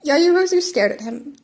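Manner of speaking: plain reading voice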